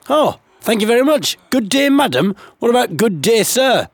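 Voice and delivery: sarcastic outraged thanks; sarcastic indignation. Acted. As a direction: bristle at being ignored. Indignant mock reply.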